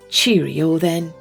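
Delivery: plain reading voice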